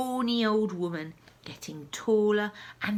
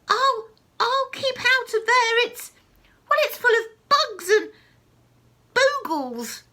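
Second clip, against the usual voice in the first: screechy grandma; 13 semitones higher, 9 dB louder than usual. Acted. Grandma voiced in the story.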